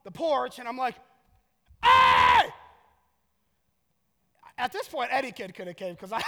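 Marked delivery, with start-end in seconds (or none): 1.8-2.5 huge one-word holler to carry down the street; projected shout. Retold or imitated. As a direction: project it as loud as you can. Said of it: "as if that's gonna project my voice".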